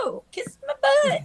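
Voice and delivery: high mock-sassy no; defiant retort. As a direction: fling it back with cheeky defiance.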